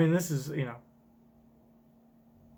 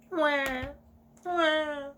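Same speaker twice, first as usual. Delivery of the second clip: put-on high wailing baby cry; hammed-up infant wah wah; mock baby cry; 11 semitones higher than usual. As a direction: wail like a newborn.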